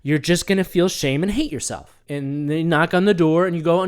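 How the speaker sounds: usual speaking voice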